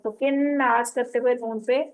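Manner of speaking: ordinary talk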